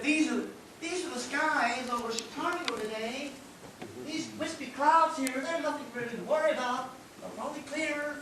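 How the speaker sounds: put-on soothing weather girl in an American accent. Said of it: "Sorry about the accent".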